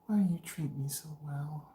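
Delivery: put-on soft breathy whisper of girlfriend. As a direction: whisper it sweetly. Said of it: "She asked me the other day".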